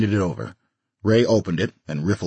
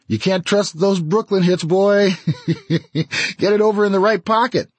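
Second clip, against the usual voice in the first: higher, louder teasing buddy voice; teasing grin; 12 semitones higher, 4 dB louder than usual. Acted. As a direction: needle him with a grin. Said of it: "grinning, Ray said".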